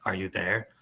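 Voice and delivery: low, soft criminal voice. Acted. Reader voicing green phone's arms dealer, low criminal voice. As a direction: ask it low and quiet like a criminal. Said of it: "he'll be like the criminal voice".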